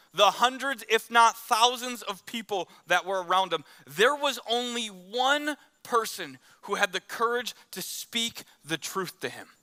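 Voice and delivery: usual speaking voice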